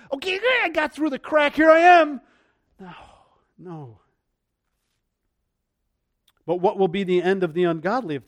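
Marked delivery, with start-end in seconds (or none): 0.1-2.2 high mock voice of a squeaker; mocking someone barely squeezing in; squeaking through. Preacher mocking a believer who barely squeaks in, high and hammed-up.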